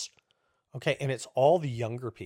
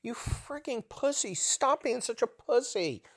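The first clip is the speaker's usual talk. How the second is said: high, strained, quieter jeer; drawn-out pitched-up exasperation; mock scolding; 8 semitones higher, 5 dB quieter than usual. Host imagines berating mask wearers, mock scolding. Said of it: "you just wanna go".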